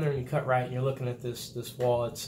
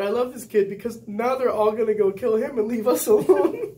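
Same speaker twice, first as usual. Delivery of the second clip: high, loud, laughing delight; laughing glee; 8 semitones higher, 7 dB louder than usual. Retold or imitated. Recruit delighted instructors are distracted: loud laughing glee. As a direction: laugh through it, thrilled. Speaker gleeful about distraction.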